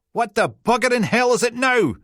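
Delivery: high crackly intercom voice of a cranky woman; grumpy old woman. A narrator's reading. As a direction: snap through the intercom. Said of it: "a woman's voice crackled out".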